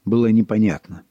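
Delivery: plain reading voice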